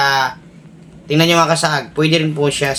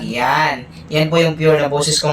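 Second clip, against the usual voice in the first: ordinary talk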